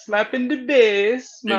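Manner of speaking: high, slow, hammed-up Jamaican accent; mock Jamaican accent. Listener quotes the movie's bass joke, mock Jamaican accent. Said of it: "trying to do this Jamaican accent".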